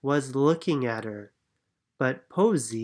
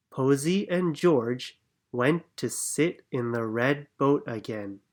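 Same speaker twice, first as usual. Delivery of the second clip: ordinary delivery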